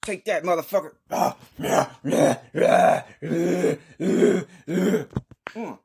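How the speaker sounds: high straining fight yells; fight grunts. Acted. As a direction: grunt through every hit. Acting out a wrestling fight.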